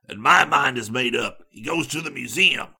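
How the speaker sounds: sharp answer in a changed character voice; sharp retort. A narrator's reading. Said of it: "Harris answered sharply".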